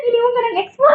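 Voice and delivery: high fake sob; play-cry about the ex; mock crying.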